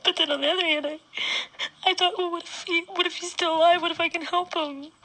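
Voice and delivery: fighting to get the words out while crying, pitch cracking upward. Real, not acted. Confronting her abusive ex through real tears, voice breaking high.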